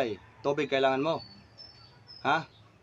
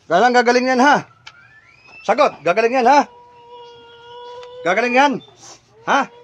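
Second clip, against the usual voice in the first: loud, higher, insistent; stern interrogation; 5 semitones higher, 7 dB louder than usual. Real, not acted. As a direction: press hard for a promise. Presses spirit about healing the patient.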